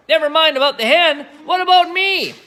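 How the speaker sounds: very high, loud shout; Grandma shouting. Acted. Grandma shouting from the rooftop for attention, very high. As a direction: call out for attention. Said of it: "Grandma shouted from the rooftop".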